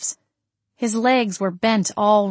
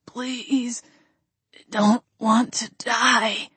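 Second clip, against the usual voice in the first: a dying man pleading to live; wet, rough plea; 3 semitones higher, much slower than usual. A narrator's reading. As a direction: beg weakly through blood. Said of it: "his voice wet and rough".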